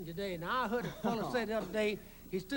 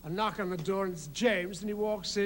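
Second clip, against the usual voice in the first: ordinary talk, nothing marked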